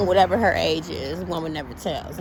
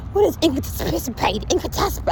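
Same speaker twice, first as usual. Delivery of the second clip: higher, indignant disbelieving question; mock outrage; 9 semitones higher than usual. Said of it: "I was mad".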